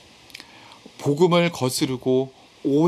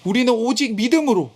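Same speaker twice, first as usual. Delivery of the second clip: high-pitched, impassioned scolding, retold; Paul's shouted rebuke; 7 semitones higher than usual. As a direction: act out an indignant reproach, voice raised in pitch. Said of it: "지금 소리를 치고".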